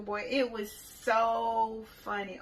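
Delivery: ordinary talk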